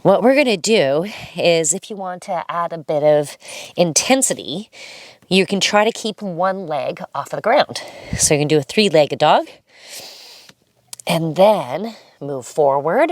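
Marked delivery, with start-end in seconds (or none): none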